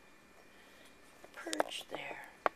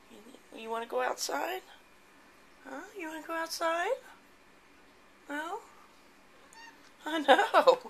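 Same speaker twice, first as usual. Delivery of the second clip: talking to a pet; 6 semitones higher than usual. Real, not acted. Talking to her cat.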